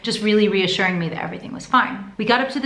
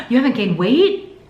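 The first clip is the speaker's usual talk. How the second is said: mock shock; 3 semitones higher, 4 dB louder than usual. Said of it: "Totally joking with me".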